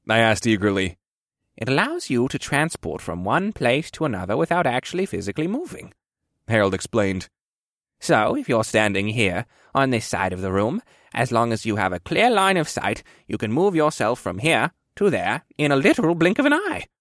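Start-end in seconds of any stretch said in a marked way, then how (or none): 1.6-5.9 put-on higher-pitched old man character voice; old quartermaster explaining carefully; instructive old quartermaster. 8.0-16.9 put-on old man character voice with swooping pitch; old quartermaster teaching a pupil; explaining old quartermaster.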